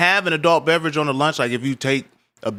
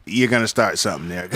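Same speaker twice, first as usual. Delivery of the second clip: usual speaking voice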